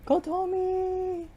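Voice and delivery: slow high stretched scream of the name; desperate drawn-out call; long drawn-out call. Acted. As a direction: drag the name out across the fire. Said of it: "screaming relentlessly".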